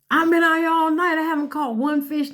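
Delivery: high, mock worried voice; hammed-up high fretful Peter; anxious Peter. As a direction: sound afraid of looking foolish.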